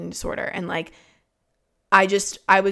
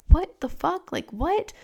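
high-pitched imitation of a clueless mom; puzzled, incredulous retold reaction; baffled mom; 10 semitones higher, slower than usual. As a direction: voice her bewilderment, pitched way up. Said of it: "She had no idea".

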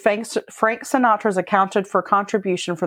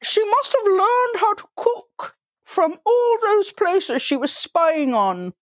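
put-on high, slow old-lady voice; Mrs. Doubtfire voice; 11 semitones higher, slower than usual. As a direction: put on a fussy elderly lady. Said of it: "she sounds like fucking Missus Doubtfire".

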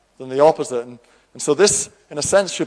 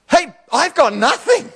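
high, slow, helpless cry of having nothing; widow's helpless reply; 13 semitones higher, 4 dB louder than usual. Acted. Widow tells the prophet she has nothing, high and pleading.

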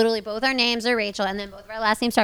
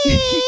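turtle squeal; 13 semitones higher than usual. Retold or imitated. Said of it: "when it was like".

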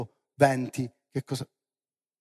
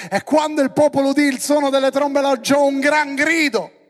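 high, loud shouted scripture; full-force preaching; 11 semitones higher, 11 dB louder than usual. Real, not acted. Preacher proclaims the people's great cry, shouting high. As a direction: build to a roaring proclamation.

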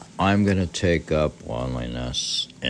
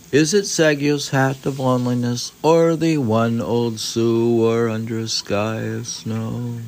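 poetic reading; 7 semitones higher, 6 dB louder than usual. Acted. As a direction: let the question hang like verse. Reads Basho text aloud.

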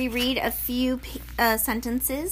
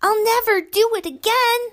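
very high, loud pleading yell; 12 semitones higher, 4 dB louder than usual. Acted. Measle yelling a promise to Basil, high and loud. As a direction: scream the promise upward. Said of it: "he yelled as loudly as he could".

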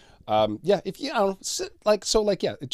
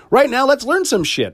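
pitched-up, loud, eager spoken lead-in; excited intro; 13 semitones higher, 8 dB louder than usual. Real, not acted. Introducing the brand new segment, excited spoken call. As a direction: hype the listeners up for the new segment. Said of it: "Oh, I'm excited".

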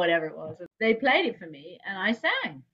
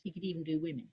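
usual speaking voice

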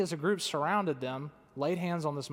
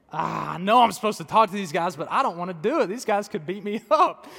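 loud, comic whine of dread; mock reluctant pleading; 2 semitones higher, 7 dB louder than usual. Man begs Lord to spare him the muscular guys, mock dread. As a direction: plead loudly, half laughing. Acted reluctant plea to God.

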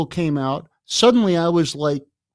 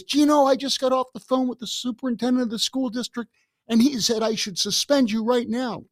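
higher, scolding principal's voice; angry principal; 10 semitones higher than usual. Retold or imitated. Vice principal scolding the student about suspension, higher angry tone. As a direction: read him the riot act. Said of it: "he started screaming at me".

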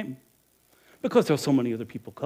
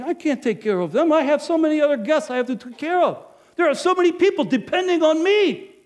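rising loud, high, defensive rant; agitated self-justification; 13 semitones higher, 8 dB louder than usual. Acted. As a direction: argue with your own conscience. Inner justification, growing agitation.

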